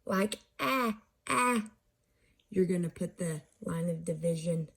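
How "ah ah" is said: "ah, ah" as two separated short A vowels, pitched up; higher demonstration of short ah, repeated; short A sound. Shown as a demonstration. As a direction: say ah twice, higher, like the A in cat. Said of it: "If it's short, like".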